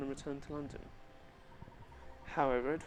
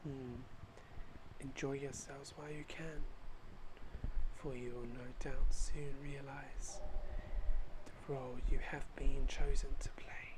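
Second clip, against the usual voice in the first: slow, soft menacing hush; a hidden figure threatens them in a whisper; sinister whisper; 4 dB quieter, slower than usual. Acted. Shadowy watcher warns the heroes, slow sinister hush. Mysterious villain line in game.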